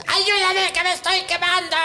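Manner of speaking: high, pleading little bread; high-pitched voice of a burning bread. Retold or imitated. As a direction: beg for help as a burning loaf. Talking bread oven begs for help.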